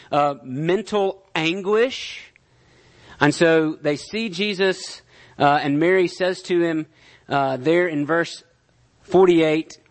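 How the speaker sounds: ordinary talk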